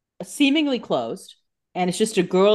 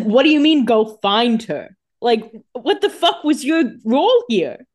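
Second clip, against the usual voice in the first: louder, higher acted outrage; incredulous scolding; 3 semitones higher, 6 dB louder than usual. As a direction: demand an explanation, playing up the disbelief. Acted quarrel, Elena challenges Stefan.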